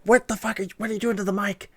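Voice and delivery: high-pitched mock outrage; mock yell. Friends scolding the noisy eater on mic, high mock yell. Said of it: "they yell at me".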